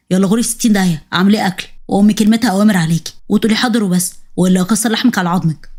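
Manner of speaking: low, menacing husband; low-voiced threatening husband; husband's threats.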